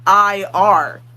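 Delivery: "I R" as two separate, stressed letter names; spelling out letters. Shown as a demonstration. Explaining how Americans read the spelling, letters distinct. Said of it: "a word that starts with".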